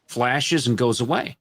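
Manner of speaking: ordinary talk, nothing marked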